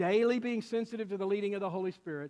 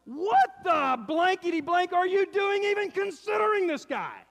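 louder, higher, slow mock outburst; exasperated shout; 9 semitones higher, 7 dB louder than usual. Pastor to the woman, a mock shouted rebuke. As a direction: let rip the rebuke he held back. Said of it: "I wanted to say to the woman".

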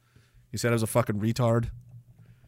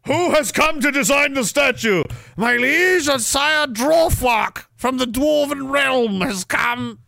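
herald announcing; 12 semitones higher, slower than usual. Pretend. Streamer imagines a herald announcing the dwarf sculptor, high and slow. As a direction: proclaim it like a royal crier. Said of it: "That sounds like the guy".

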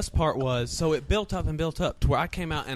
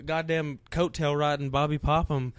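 normal talking voice

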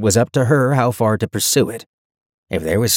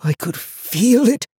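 slow, high, haunted voice; strained, fearful stage whisper; shuddering; 13 semitones higher, slower than usual. A narrator's reading. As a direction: draw it out in dread. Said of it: "Lucas shuddered".

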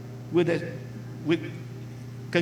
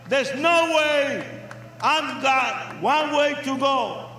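preaching at full force; 4 semitones higher than usual. Real, not acted. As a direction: proclaim it to the crowd. Preaching climax to the crowd.

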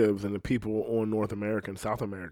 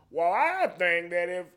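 mock a Southerner trying to sound learned; exaggerated high Southern accent; Southern accent, talking intelligently; 10 semitones higher, 3 dB quieter than usual. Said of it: "you don't hear someone try to talk intelligently".

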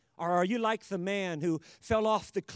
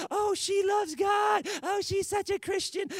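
high, mock-adoring squeal; gushing infatuated admirer; 12 semitones higher than usual. Preacher mimicking someone fooled by a sweetheart, gushing high. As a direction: swoon over a sweetheart in a high voice.

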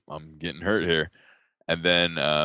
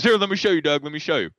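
higher, louder cheery "let me show you"; instructor offering help; 7 semitones higher, 6 dB louder, faster than usual. Retold or imitated. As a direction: mimic the instructor keen to demonstrate. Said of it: "He goes".

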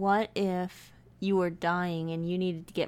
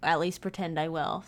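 ordinary delivery

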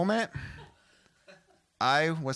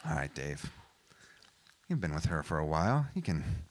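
low, subdued self-talk; internal monologue; 9 semitones lower, 5 dB quieter than usual. Acted. Comic coaxing himself into a dreaded favour, low inner monologue. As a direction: talk yourself into it under your breath. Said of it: "this internal monologue with myself".

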